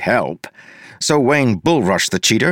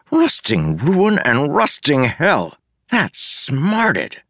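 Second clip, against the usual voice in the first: higher, slow-drawn curse of pain; pained exclamation; 6 semitones higher, much slower than usual. A narrator's reading. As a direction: curse as if it really smarted. Character's pained outburst in narration.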